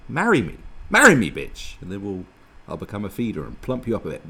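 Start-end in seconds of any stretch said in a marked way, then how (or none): 0.1-1.8 loud, high begging that builds; mock impassioned proposal. Suitor proposing to an amazing girl, hammed-up and loud. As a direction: blurt it louder the second time.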